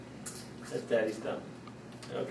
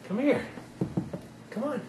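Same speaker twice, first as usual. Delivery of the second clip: high coaxing; 9 semitones higher, 5 dB quieter than usual. Real, not acted.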